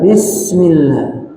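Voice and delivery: bismillah with the sin hissed, slowly; slow model basmala. Shown as a demonstration. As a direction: stretch bismillah, hiss the s. (bismillah dengan sin berdesis)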